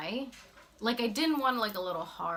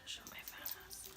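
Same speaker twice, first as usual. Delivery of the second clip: whisper; 12 semitones higher, 15 dB quieter than usual. Real, not acted. Embarrassed aside about a photo.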